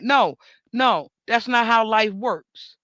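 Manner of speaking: ordinary talk, nothing marked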